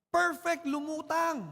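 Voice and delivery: put-on boy cheering his floating boat, thrilled and high; delighted. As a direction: cry out in joy as the boat floats.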